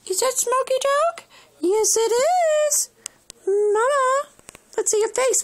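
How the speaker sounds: high, excited pet talk. Real, not acted. Baby-talking the cat.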